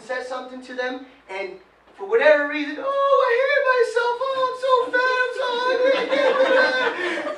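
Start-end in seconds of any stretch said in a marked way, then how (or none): 2.8-7.4 high, loud, whiny mocking voice; mock self-pity whine. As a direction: mimic someone wallowing in self-pity, nasal-free but shrill... keep it high and loud. Mocking self-pitying people.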